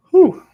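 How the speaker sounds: high, loud whew; bourbon-kick exhale, high and sudden; whew after a sip. Real, not acted. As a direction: let out a sharp whew at the strong drink.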